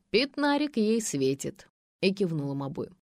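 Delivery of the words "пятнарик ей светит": ordinary delivery, nothing marked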